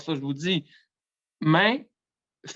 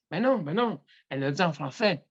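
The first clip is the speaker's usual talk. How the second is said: usual speaking voice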